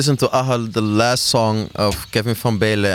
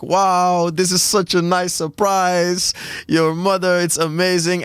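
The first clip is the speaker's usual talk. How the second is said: much higher, delighted grandmother voice, slowing on the surprise; grandmother's delight; 9 semitones higher, slower than usual. Retold or imitated. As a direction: play the grandmother thrilled by the surprise. Grandmother voiced in retold voice note.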